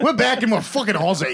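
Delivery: laughing, loosely put-on Aussie voice; Australian accent. Co-host announcing they are Aussie, imitation Australian accent. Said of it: "my Australian accent isn't quite as good".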